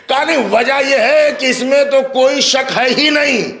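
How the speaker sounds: soar and hold the words like a majlis orator; preaching at full force. Acted. Preacher to the majlis audience, raising the story to a sung climax. Preacher's raised narration returns.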